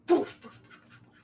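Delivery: puffed crash sound effect, fading away; imitated crash. Game master voices a crash outside, puffed fading effect. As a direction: burst of puffs trailing off into breath. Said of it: "a big crash".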